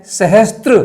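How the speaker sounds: सहस्त्र said with an extra t, "sahastra"; wrong pronunciation. Shown as a demonstration. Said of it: "कितना गलत उच्चारण होता है।".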